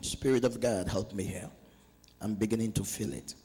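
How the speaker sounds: low, soft murmured appeal; hushed aside prayer. Real, not acted. Preacher asks the Spirit of God for help, hushed prayer. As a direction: drop right down, praying half to yourself.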